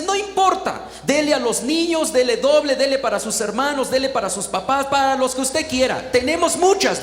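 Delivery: high, loud, pressing list; generous insistence. Retold or imitated. Donor urging backpacks for everyone, generous insistence.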